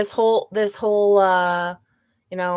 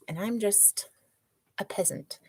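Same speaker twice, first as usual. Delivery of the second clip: breathy whisper, self-mocking; whispered aside; 7 semitones lower, 14 dB quieter, faster than usual. Real, not acted. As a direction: drop to a whisper for the punchline. Self-deprecating aside about himself.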